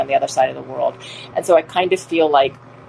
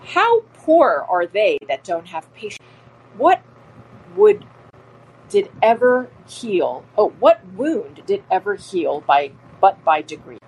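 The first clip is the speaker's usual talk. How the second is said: Othello recital; 3 semitones higher than usual. Shown as a demonstration. Teacher reading Othello to the class, dramatic. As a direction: perform the line with feeling. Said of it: "The next quote is from Othello".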